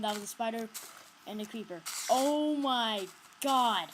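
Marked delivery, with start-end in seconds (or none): 2.1-3.9 long, loud drawn-out oh my god; slow, loud shocked exclamation; startled. Real, not acted. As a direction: gasp it out in shock. Startled by monsters.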